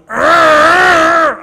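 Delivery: loud imitated demon growl; a raw loud growl; growl imitation. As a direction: growl it out loud like a possessed child. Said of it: "just growling, making this awful noise".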